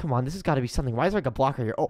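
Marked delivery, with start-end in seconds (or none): none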